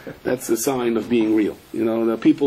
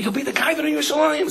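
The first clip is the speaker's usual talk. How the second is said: quick, raised quote of the askers; voicing the askers; 12 semitones higher, faster than usual. The askers reply to the rav, quick raised voice. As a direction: rattle off their answer, lightly mocking.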